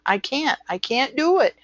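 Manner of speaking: high-pitched, hammed-up helplessness; mock panic. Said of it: "if you panic every time".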